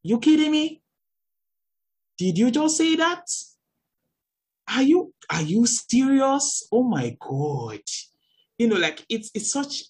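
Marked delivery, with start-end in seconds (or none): 0.0-7.8 incredulous. Retold or imitated. Shocked at adults dismissing young people's grief, high disbelieving cry. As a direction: gasp it out, can't believe what you heard. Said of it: "I'm like".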